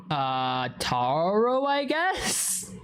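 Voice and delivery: mock unsure squeak; acted uncertainty. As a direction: answer hesitantly, pitch climbing at the end. Acts unsure reply to vendor.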